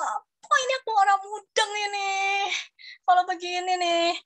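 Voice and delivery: bewildered outburst. Retold or imitated. Retold bewilderment at Mandarin.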